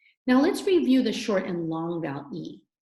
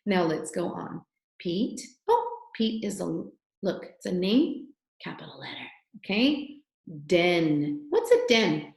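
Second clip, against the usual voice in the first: ordinary talk, nothing marked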